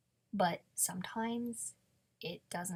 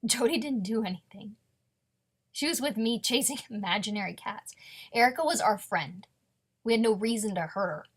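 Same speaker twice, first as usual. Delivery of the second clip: much louder, heated defensive outburst; indignant protest; 2 semitones higher, 10 dB louder than usual. Acted. Suspect protesting to questioner that the friend is innocent, indignant shout. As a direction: raise your voice to defend your friend.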